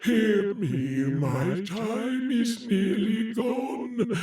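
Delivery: solemn, mournful ghost voice, slowed in places; a weary spirit warning the living; doleful spectre bound in chains; ghost of Marley. Acted. Marley's ghost speaks to Scrooge.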